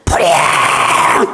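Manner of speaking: long, drawn-out shout of alarm; shouting fire. Shown as a demonstration. Pastor showing how to shout when a fire breaks out. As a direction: bellow it very slowly. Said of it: "불이 나면은".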